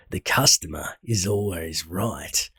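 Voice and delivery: low, hushed villain voice; Cyber Psycho villain. A narrator's reading. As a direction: drop deep and quiet as the taunting psycho. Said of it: "an Australian accent works for him".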